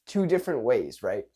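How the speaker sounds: ordinary delivery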